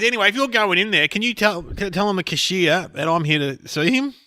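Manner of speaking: high bloke voice asking a favour; casual, cheeky request; imitating a bloke. A stranger asking the comedian to fetch the cashier, high.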